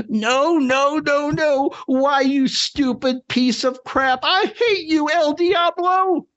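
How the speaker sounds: anguished wail. Acted. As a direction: wail at the broken rover like a ruined old man. Ed's rover crashes, candy lost.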